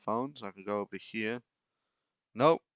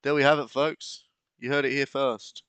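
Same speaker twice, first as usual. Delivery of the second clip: louder mock-announcer delivery; newscaster voice; 4 semitones higher, 7 dB louder than usual. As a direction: present it like breaking news. Mock broadcast sign-off.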